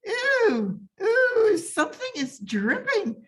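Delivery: high squeals of disgust; disgusted shriek. Acted. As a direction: recoil with a high ew. Sally disgusted by dripping.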